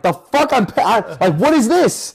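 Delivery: high, loud, outraged squawk; outraged disbelief. Retold or imitated. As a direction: yelp it in shocked outrage. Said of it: "I'm like".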